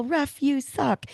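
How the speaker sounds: high, drawn-out mock yell, volume held back; retold heckling yell. Speaker retells heckling the ref after that call, mock yell. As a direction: yell at the ref without real loudness. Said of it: "I had to yell".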